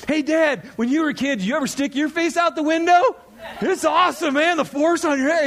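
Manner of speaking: put-on high, excited little-boy voice; Caleb. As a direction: imitate an eager kid pestering dad. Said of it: "my little boy Caleb goes".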